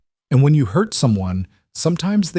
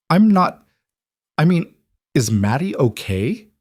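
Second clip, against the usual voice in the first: startled, higher-pitched faltering start; alarmed; 7 semitones higher than usual. Acted. Woman hears the child is in trouble, alarmed. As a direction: break off, then ask anxiously.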